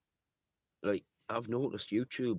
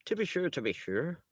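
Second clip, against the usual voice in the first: mock stage-Irish lilt, much higher; Irish accent; 9 semitones higher than usual. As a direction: ham up a cheesy Irish accent, pitched up. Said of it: "they're all Irish".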